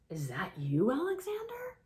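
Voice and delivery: whisper, then a sharp high rise on the name; hushed disbelief, then joy; whispered surprise. Acted. Owner recognizing Alexander, whispered then rising. Owner finds lost kitten.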